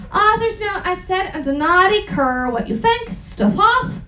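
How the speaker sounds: ordinary talk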